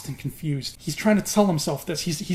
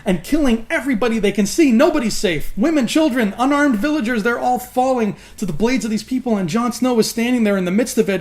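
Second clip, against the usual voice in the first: ordinary talk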